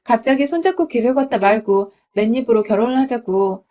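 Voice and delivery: plain reading voice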